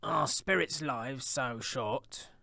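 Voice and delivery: very slow, wondering question; Scrooge asking. A narrator's reading. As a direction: ask the ghost in wonder. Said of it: "asked Scrooge".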